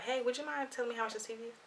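soft, quick casual question. Retold or imitated. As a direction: ask it offhand and quietly, like it's nothing. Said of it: "ask him just very casual".

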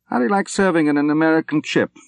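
put-on hearty old general. A narrator's reading. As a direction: play the cheerful old soldier who has made peace with dying. General voiced again.

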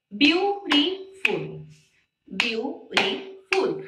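beautiful stretched out slowly, each syllable clear. Shown as a demonstration. Teacher to class, slow syllable-by-syllable beautiful. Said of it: "Has three syllables".

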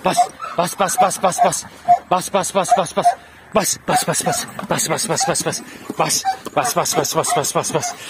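rapid-fire repeated command; quick staccato urging; egging the dog on. Real, not acted. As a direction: chant the order in quick bursts to spur on a dog.

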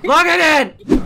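loud outburst. Real, not acted. Outraged at the game.

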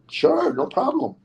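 high, drawn-out yes; eager agreement. Retold or imitated. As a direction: stretch out an easy, high-pitched acceptance. Retold eager yes to job offer.

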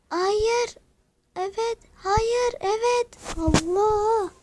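very high, frantic squeals; panicked exclamations. Real, not acted. Gamer drifting on the edge of crashing, panicked exclamations. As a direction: yelp each word as the car nearly crashes.

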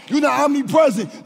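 usual speaking voice

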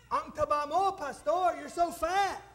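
loud, high mock teasing. Church members tease their pastor about his weight, teasing. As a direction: call it out high and loud, poking fun. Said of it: "They tease me about that".

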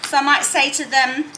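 ordinary delivery

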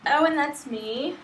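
ordinary delivery

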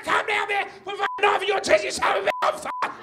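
high, shrieking threats at top pitch; furious caller. Acted. Furious caller screaming at a call centre worker, enraged shriek. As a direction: scream down the phone in a frantic rage. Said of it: "they'd be furious".

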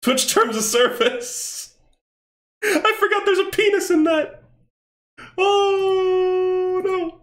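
very high panicked squeal; comic panic. Real, not acted. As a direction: squeal in alarm as it sinks in. Panics over forgotten rule-breaking game content.

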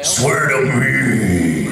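very slow, drawn-out Batman growl. Retold or imitated. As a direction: drag the words out like a brooding caped crusader.

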